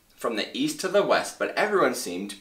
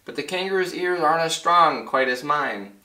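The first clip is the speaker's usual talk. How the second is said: high, raised urgent call; calling back; 6 semitones higher, 3 dB louder than usual. Acted. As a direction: call out anxiously to the tiny town. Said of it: "Horton called back".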